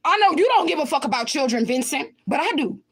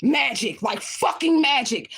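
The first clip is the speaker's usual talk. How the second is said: ordinary delivery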